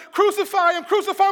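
high, loud mob chant; forceful, repeated yell; yelling crowd. Acted. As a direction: shout it like a crowd demanding death. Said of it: "he sees the people yelling".

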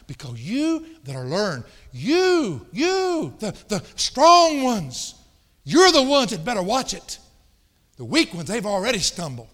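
high, loud shouted warning to the strong; preaching at full force. Acted. Preacher shouting a warning to the strong ones in church. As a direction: hammer it home like a revival preacher.

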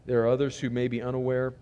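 low, soft, measured prayer; public prayer. Real, not acted. Pastor praying for his church before the sermon, low and hushed. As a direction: pray quietly with pauses between petitions.

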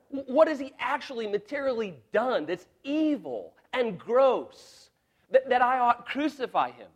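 Pilate begging the crowd, high and loud; a flustered governor trying to calm a mob; pleading Pilate. Acted. Pilate pleading with the crowd, loud and high.

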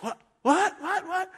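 stunned, high repeated what; confused surprise. Retold or imitated. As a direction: act the baffled birthday guest. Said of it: "the person's like".

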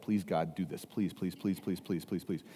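quiet pleading prayer. Retold or imitated. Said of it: "probably praying in the back of his head".

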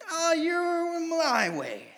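high, slow, sugary "Oh, you're my way"; drawn-out falsetto devotion; mock devotion. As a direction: gush it like a fake convert.

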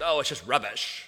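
a light, understated British voice saying rubbish; British accent. Retold or imitated. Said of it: "you gotta put on the accent".

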